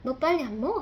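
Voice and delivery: mock-scold a dawdling kid, pushing for speed; retold angry scolding. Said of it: "저도 화가 많이 나서 막".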